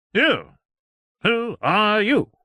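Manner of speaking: high, loud, slow affronted challenge; shocked, haughty doctor; indignant demand. Acted. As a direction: demand it like an outraged official. Doctor confronting Strange at castle.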